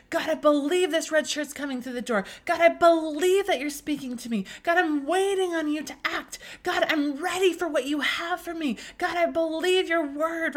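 expectant praise. Retold or imitated. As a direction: pray it out like the answer is already at the door. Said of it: "I'm just speaking to him in, in expectancy".

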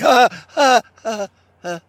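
high, loud fake jock laugh; a hammed-up "ha ha" to the crowd; bully's put-on laugh that fades out; mock laugh. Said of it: "looking at all his friends and the cheerleaders like".

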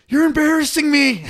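high, loud, hurt complaint; mock indignant whine. Drunk man tells the cops they embarrass him, high loud whine.

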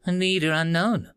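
flat machine translator voice; robotic monotone. Acted. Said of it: "in a monotone voice".

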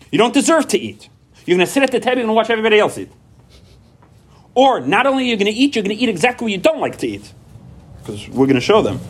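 high-pitched ranting parent; high mock rage laying down the punishment; angry parent punishment. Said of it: "with a little wimpy voice, or anger or rage".

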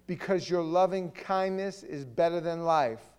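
loud psalm reading, building in force; declaimed scripture. Real, not acted. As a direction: proclaim it phrase by phrase, drawing out key words. Reads psalm aloud with force.

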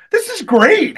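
high, loud gushing; gushing delight. Retold or imitated. Fan gushing about a caveman movie, high gleeful praise. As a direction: rave about it. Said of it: "I was like".

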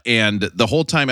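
ordinary talk

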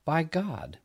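ordinary delivery, nothing marked

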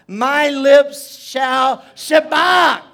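slow, loud build to a cry; high, drawn-out proclamation; scripture shouted. Shown as a demonstration. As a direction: stretch it out and shout the last word. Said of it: "I will shout out".

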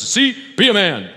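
loud, high order to a son; mock fatherly command. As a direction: imitate a dad exhorting his boy. Acting fathers addressing their sons.

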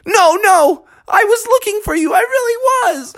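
very high, fast pleading; high pleading Froggy. A narrator's reading. Froggy pleading with upset Beatrice, high and fast. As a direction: babble excuses, very high.

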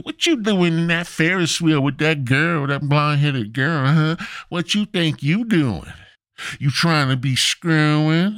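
put-on high, nagging girlfriend voice; scolding girlfriend. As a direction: give him a high-pitched earful.

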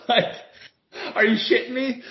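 high, laughing disbelief; incredulous. Real, not acted. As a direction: squeak it out in disbelief. Incredulous laugh about racist take.

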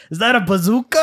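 put-on thick New York accent quote. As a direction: say it like a New York tough guy. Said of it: "thick New York accent guy".

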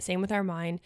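ordinary talk, nothing marked

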